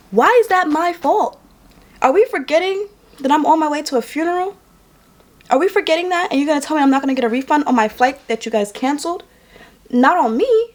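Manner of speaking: retold indignant challenge to an airline; indignant protest. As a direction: argue back in high-pitched disbelief. Acted argument over refused refund.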